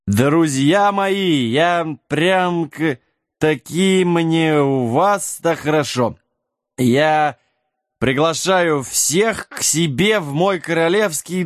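tipsy, dragging each word out fondly; drunk toast. Acted. Drunk Jack toasting his little bearded friends, slurred and slow.

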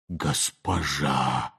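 faint, slow, awestruck murmur; barely audible. A narrator's reading. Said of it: "еле слышно произнёс он".

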